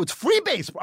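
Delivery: high-pitched, mildly yelled correction; retold yelling. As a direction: yell it at a clueless friend. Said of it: "I'm, like, yelling at him".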